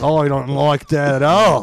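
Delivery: loud, slow put-on objection; imitated indignant headliner. Headliner objecting to a comedian's ban, loud mock indignation. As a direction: object loudly, dragging the words out.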